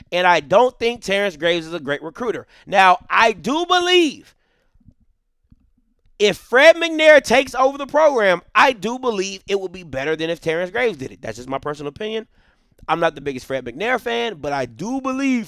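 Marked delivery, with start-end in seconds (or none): 0.1-11.2 heated rant. Real, not acted.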